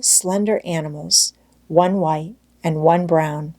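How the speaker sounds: plain reading voice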